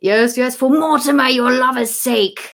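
loud theatrical jab; hammed-up stage king; King Edward's retort. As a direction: declaim it, slowing on the last words.

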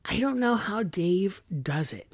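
soft, breathy aside of amazement; hushed wonder. Retold or imitated. Fan recalling his reaction to the album, hushed wonder. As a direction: say it softly, marvelling to yourself. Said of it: "I'm like".

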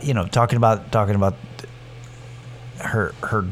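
normal talking voice

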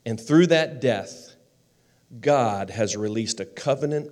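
projected sermon delivery, deliberate pauses; big, emphatic pulpit voice; impassioned preaching. Real, not acted. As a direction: preach it out loud, phrase by weighty phrase.

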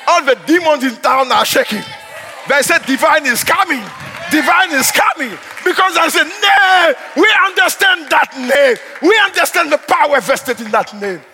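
loud, high declaiming; fiery preaching. Acted. Pastor telling the church demons tremble, loud preaching. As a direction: preach it like a battle cry.

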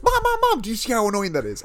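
high, loud mock outburst; loud, high-pitched imitation of a snapping parent; exasperated mom. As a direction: act out a fed-up mom turning round. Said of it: "the mom just turns around and goes".

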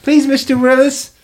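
high, loud pleading character voice; Perla. Acted. Captain begging passenger to get off the boat, high urgent voice. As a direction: plead urgently, pitched far above your own voice. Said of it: "She says".

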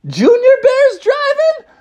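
very high, drawn-out incredulous question; alarmed disbelief. Acted. Bears discover who has the wheel, high shocked voice. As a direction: squeal it slowly in disbelief.

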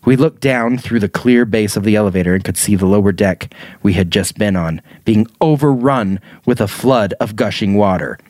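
ordinary talk

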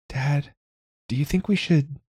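put-on small high girlish ask; a young girl timidly asking her dad; child's voice. A narrator's reading. Girl asking Dad on the train, high and hesitant.

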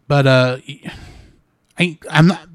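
usual speaking voice

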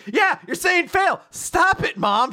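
high, loud, drawn-out protest; hammed-up daughter telling off a parent; exasperated shout. Retold daughter scolds mom.

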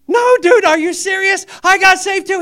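high, loud, astonished dude; amazed excitement. Retold or imitated. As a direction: blurt it out, thrilled and disbelieving. Telling brother he got saved.

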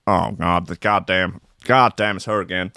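lightly voiced, low weary impression; half-hearted attempt at a politician's voice; Bill Clinton. Imagining the ex-president fed up with Hillary, terrible impression. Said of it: "that's a terrible Bill Clinton impression".

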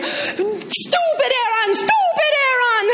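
high, laughing mock shout; Italian guide howling the ex's name for fun; retold screaming. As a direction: yell it into the street like a madman. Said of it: "screaming as scooter people".

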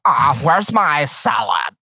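slow, drawn-out snooty voice; snooty office worker. Retold or imitated. Snooty office person demanding lunch from caterer, haughty drawl. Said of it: "snooty office people".

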